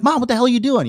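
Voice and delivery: high-pitched exasperated reproach; yelling, not screaming. Retold or imitated. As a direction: imitate scolding mom without a full scream. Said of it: "like, yelling at my mom".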